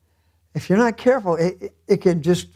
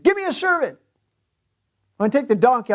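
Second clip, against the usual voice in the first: mock yell; 6 semitones higher, much faster than usual. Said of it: "yells at him".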